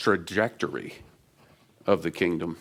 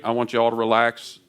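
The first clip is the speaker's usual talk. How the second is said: ordinary talk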